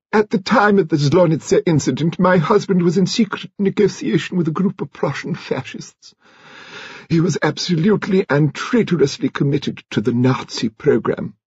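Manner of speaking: high-pitched, measured grande dame; lady confessing. A narrator's reading. Lady Lacklander revealing her husband's treachery, high and formal.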